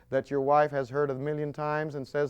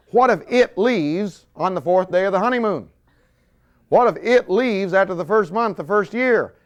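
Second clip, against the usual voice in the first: raised, louder anxious voice; hint of panicky young single; imitating worried singles; 6 semitones higher, 9 dB louder than usual. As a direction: play the nervous single person. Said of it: "and they're saying".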